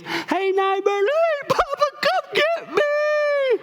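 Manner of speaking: very high, slow wailing cry; hammed-up childish wail down the phone. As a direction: imitate a sobbing four-year-old begging.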